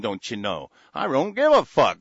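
usual speaking voice

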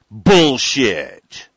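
loud, scornful outburst; a sharp contemptuous bark; scoffing. Real, not acted. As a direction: spit out the word in disgust. Scoffing at news patent claim.

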